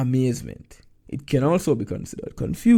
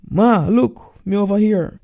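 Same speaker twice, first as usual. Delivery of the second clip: higher, louder call across the platform; 9 semitones higher, 8 dB louder than usual. Acted. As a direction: shout to get mother's attention. Tolroy calls out to mother.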